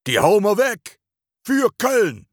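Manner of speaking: high-pitched fighting chant, other voice; battle roar. Acted. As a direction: bellow it like a hooligan charging. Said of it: "Schallt es direkt gewaltig".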